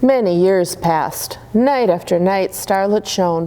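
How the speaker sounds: usual speaking voice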